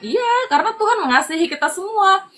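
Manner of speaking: high-pitched, exclaimed answer; emphatic reply. Retold or imitated. Mentor answering an amazed new believer, emphatic reply. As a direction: voice jumps up high to act out the reply.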